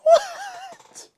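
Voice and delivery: very high disbelieving squeak; incredulous squeal. Real, not acted. Listener reacting through laughter.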